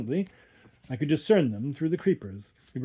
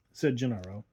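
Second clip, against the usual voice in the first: usual speaking voice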